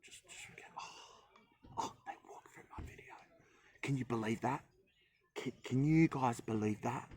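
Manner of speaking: whispered, then a mock-indignant complaint; grumpy influencer whisper. Mocking grumpy influencers, muttering complaint. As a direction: play a petty influencer hissing about strangers. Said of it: "get grumpy like some influencers".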